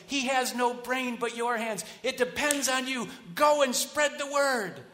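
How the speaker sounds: builds from normal to a high, loud rallying cry; rousing pep sermon. Shown as a demonstration. Mock inspirational preacher rousing the congregation, building to a shout. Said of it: "the inspirational ger-, version goes like this".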